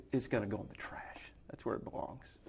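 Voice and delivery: hushed whisper to himself; whispered aside. Retold or imitated. Dismissing his father's tackle box, whispered. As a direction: breathe it out like a private verdict.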